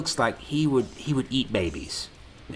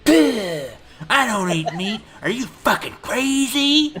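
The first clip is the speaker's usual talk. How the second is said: mock snooty vegan, much higher and louder; snooty vegan girl; 10 semitones higher, 5 dB louder than usual. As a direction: sneer it like an outraged hipster. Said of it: "you say".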